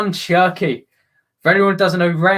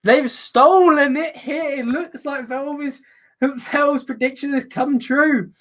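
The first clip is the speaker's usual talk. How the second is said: high, slow, emphatic commentary; excited goal confirmation; 8 semitones higher, slower than usual. Real, not acted. Commentator confirming Lyon have stolen it, excited. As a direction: stretch each phrase in high excitement.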